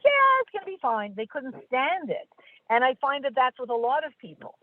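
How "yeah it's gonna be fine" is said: high, breezy brush-off; imitated happy-go-lucky assurance; carefree reassurance. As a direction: wave the worry away, pitched up. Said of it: "really annoyed to hear this".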